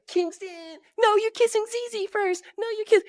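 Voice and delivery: put-on very high, excited kid voices; kids squabbling. Dad voicing his kids arguing at school drop-off. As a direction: squeal it like little kids arguing over who's first. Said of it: "And they're like".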